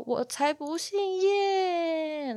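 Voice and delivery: high, very slow mock wail; long-drawn cry. Owner imitating cat denying the surname Ye, drawn-out wail. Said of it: "然后就拉长音".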